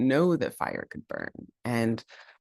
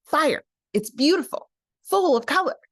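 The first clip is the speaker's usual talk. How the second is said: excited; 5 dB louder than usual. Acted. Raven excited about fire.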